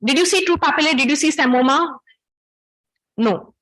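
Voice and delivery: ordinary talk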